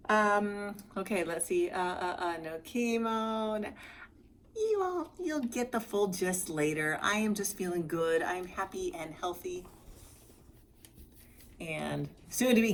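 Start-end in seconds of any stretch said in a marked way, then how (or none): none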